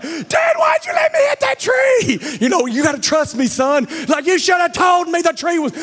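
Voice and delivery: put-on high, loud whiny kid shouting at dad; mock kid yelling at his dad; arguing son. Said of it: "him and his dad are arguing".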